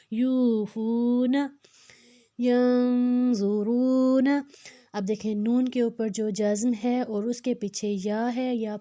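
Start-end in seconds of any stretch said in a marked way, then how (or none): 0.1-1.4 slow یوفون, long "yoo-foo", brief "na"; long vowels. Shown as a demonstration. Teacher shows equal stretching, drawn-out vowels in یوفون. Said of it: "نا کو ایک حرکت". 2.4-4.3 slow, louder ینظرون, the n hummed through the nose; nasal ghunna. Shown as a demonstration. Teacher introduces ghunna, nasal drawn-out n in ینظرون. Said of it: "اس میں ہم تھوڑا سا غنہ کریں گے".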